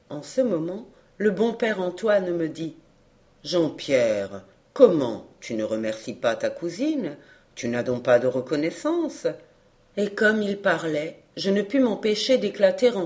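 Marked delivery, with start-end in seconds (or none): 3.4-9.3 low-pitched paternal reproach; reproachful old man. Acted. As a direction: lower the voice and chide the boy. Good old man gently reproaches boy. (voix grave de reproche paternel)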